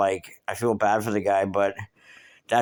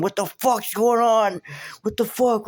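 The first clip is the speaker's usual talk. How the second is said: landlord's high-pitched, drawn-out yell; mock a screaming landlord, voice way up high; imitated screaming; 12 semitones higher, slower than usual. Landlord in the crowded hallway after the explosion, imitated screaming. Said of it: "the landlord's screaming".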